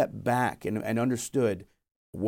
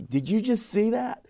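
high, louder astonished question; amazed; 12 semitones higher, 4 dB louder than usual. Retold or imitated. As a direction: blurt it out, stunned at the sign. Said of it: "I was like".

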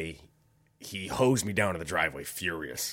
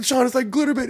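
frantic pleading; 9 dB louder, faster than usual. Retold or imitated. Frantic explanation to injured friend.

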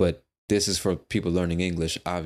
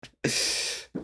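laugh; 4 dB quieter than usual. Real, not acted. Laughs at his own Thai.

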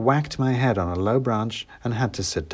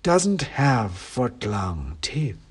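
snooty bored cat; 3 semitones higher, much slower than usual. A narrator's reading. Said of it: "said the cat from the shadows".

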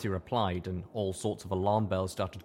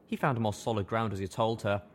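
ordinary delivery

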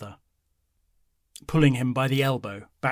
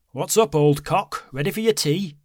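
cheeky loud greeting; 6 semitones higher, 7 dB louder than usual. A narrator's reading.

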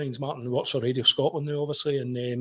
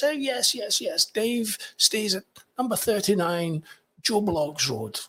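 high, drawn-out mock expat voice; imitating expat; 8 semitones higher, slower than usual. Expat giving out Dave's address, high imitated voice. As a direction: put on a slow, high-pitched expat.

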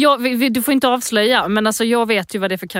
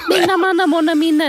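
mock warm-up; 8 semitones higher, 4 dB louder than usual. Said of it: "börjar jag göra så här".

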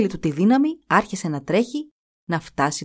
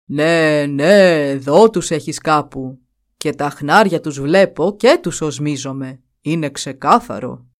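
lower, louder buffalo voice, insistent; the buffalo chief accusing Petra, lower and louder; 4 semitones lower, 4 dB louder than usual. A narrator's reading. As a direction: press the accusation down low.

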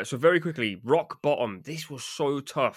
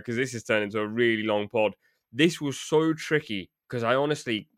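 usual speaking voice

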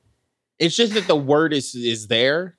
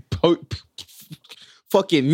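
ordinary talk

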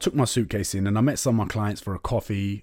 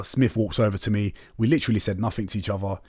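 normal talking voice

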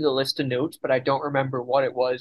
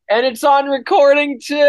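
gleeful gloating; 9 semitones higher, 6 dB louder than usual. Real, not acted. Gloating, calling out.

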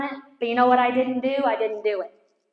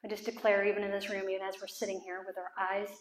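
prayer; 2 semitones lower, 7 dB quieter than usual. Real, not acted. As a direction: declare it quietly over bowed heads. Leads a closing prayer.

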